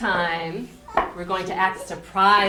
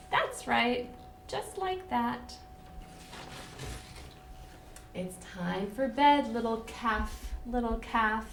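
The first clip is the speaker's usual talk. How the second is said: gentle sing-song bedtime read; reading to children; 4 semitones higher than usual. Acted. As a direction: lull them toward sleep. Reads bedtime book to children.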